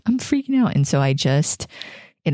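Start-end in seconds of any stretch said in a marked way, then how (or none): 0.1-0.7 high, panicky admission; mock frantic confession; retold freaking out. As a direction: blurt out the panic, pitched high. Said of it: "I'm like".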